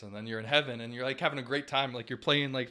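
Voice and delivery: ordinary talk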